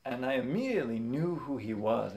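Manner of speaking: normal talking voice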